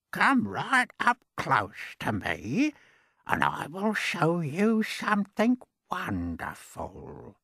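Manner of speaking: put-on slow, creaky old man coaxing. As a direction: beckon the boy in, slowly. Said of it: "his voice was very slow and creaky".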